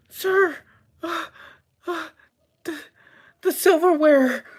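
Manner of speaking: gasping, high squeaky stammer; very high, halting, frightened voice; panicked waitress. Acted. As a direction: stammer in falsetto as a shaken waitress. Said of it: "the waitress says".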